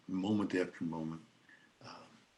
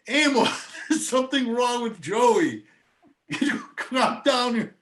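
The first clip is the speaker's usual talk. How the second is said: retold yell; 7 dB louder, slower than usual. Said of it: "I could hear her yelling".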